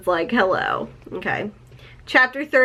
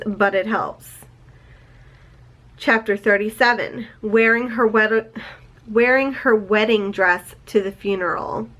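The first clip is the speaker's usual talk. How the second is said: ordinary talk, nothing marked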